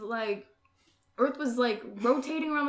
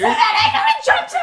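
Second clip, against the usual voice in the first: much higher, fast, louder laughing rush; frantic laughing; 12 dB louder, faster than usual. Retold or imitated. Telling friends about saving her cousin, frantic and laughing. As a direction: tumble it out.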